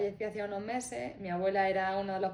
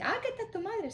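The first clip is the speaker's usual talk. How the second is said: surprised lady; 13 semitones higher than usual. Retold or imitated. Retold quote of the lady.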